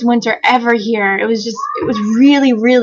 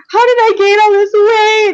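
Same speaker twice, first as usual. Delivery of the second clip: high, loud mock wail; hammed-up panicked cry; hysterical freak-out; 11 semitones higher, 5 dB louder than usual. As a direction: wail it like a meltdown being re-enacted. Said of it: "I'm, like, freaking out".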